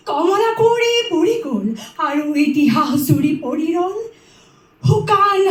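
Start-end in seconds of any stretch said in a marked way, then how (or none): 0.1-1.7 verse line repeated on a high pitch; high, lifted recitation. Acted. Reciter repeating the line on the aged princess, high. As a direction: lift Kamala Konwari high and hold it up.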